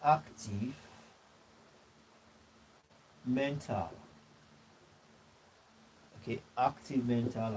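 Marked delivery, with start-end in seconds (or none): 0.0-3.9 each word dropped alone with long pauses; dictating slowly. Real, not acted. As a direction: give them time to write each word. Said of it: "dictating notes to the class".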